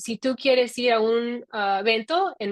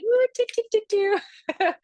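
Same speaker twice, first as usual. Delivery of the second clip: playful jabbering in a mock Italian sing-song, much higher; 13 semitones higher, slower than usual. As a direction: gibberish Italian, hammed up and squeaky. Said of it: "So I'll be like".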